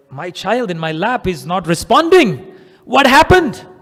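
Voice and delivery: panicked mother, rising to a cry; acted maternal panic; mock scream. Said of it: "She screams".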